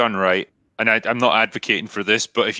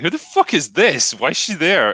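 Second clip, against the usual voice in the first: raised, stretched-out mock bewilderment; retold bafflement; 6 semitones higher than usual. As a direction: replay your disbelief at seeing her, pitched up. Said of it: "seeing her just like".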